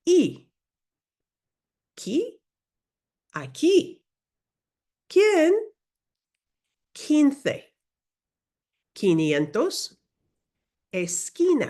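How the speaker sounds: teacher leading a repeat-after-me drill; pronunciation drill. Shown as a demonstration. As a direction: call out "¿Quién?" and "Quince" clearly for echoing. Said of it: "Repeat after me".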